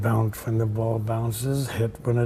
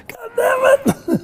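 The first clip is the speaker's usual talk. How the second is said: high, loud, cracking curse; frustrated curse; 9 dB louder than usual. Retold or imitated. Student cursing his own backhand, high and breaking. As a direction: let it break out in despair.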